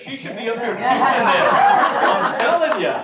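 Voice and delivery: loud, high, laughing exclamation; laughing praise. Real, not acted. Teacher jokes to class that pupil should teach, laughing loudly. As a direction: crow it over the laughter, stretching the end.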